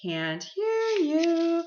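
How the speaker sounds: high, drawn-out sing-song; pretending not to hear. A narrator's reading. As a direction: sing it out as if hard of hearing. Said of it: "pretending not to hear".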